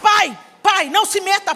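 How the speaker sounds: enfrentando o pai. Retold or imitated. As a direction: cut him off sharply. (filha gritando com o pai, irritada)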